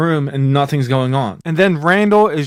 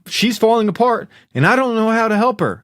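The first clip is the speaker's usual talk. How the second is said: higher-pitched, mildly distraught pleading; retold desperate plea; 8 semitones higher than usual. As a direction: plead helplessly, as if acting out distress. Retold son pleading with father.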